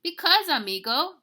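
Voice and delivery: high, loud, theatrical explanation; big-dog leader. Acted. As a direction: proclaim it to your amigo. Don Diego, biggest dog, explains.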